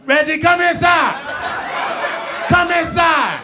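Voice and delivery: mother calling out. Retold or imitated. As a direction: yell it like a mom from upstairs. Mother yelling out window.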